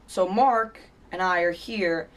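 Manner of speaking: ordinary talk, nothing marked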